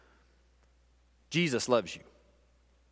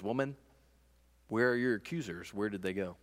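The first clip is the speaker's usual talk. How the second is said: softly, tenderly asking; Jesus gently asking the accused woman; gentle Jesus; 4 semitones lower, 6 dB quieter than usual. Acted. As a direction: ease her fear with a soft voice.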